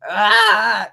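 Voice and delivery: sudden high yell of rage; a powering-up battle cry; rage scream. Retold or imitated. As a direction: let out a wild rage scream. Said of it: "rage, you know".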